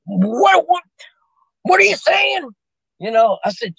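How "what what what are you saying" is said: sharp, much higher irritated snap; annoyed. Retold or imitated. Annoyed sergeant snapping back at the pestering soldier. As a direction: bark it back, fed up and irritated. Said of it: "now he's getting mad at me".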